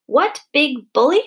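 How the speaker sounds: high, loud, drawn-out echo of disbelief; outraged shriek. A narrator's reading. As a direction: throw the question back, shrieking and slow. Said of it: "shrieked Missus Eager".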